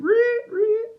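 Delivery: very high, loud squeaky reet; guinea pig squeak. Retold or imitated. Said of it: "run away like a guinea pig".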